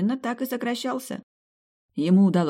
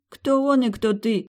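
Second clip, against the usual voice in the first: с презрением; 8 semitones higher, 5 dB louder, slower than usual. A narrator's reading. Мать презрительно принижает дочь.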